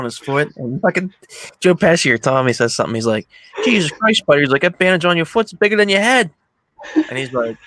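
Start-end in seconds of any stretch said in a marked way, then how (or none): 3.7-4.4 loud, high retold exclamation; pitched-up, exasperated jeer; mock exasperation. Acting out Tommy mocking Spider. 4.6-6.3 loud, raised-pitch teasing; pushed, high mock taunt; imitated jeering. Acting out Tommy teasing Spider.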